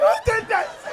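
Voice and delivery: high, loud startled yell; outraged yell. Retold or imitated. Man splashed with water in the cinema, high outraged yell. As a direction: shriek it like you just got splashed.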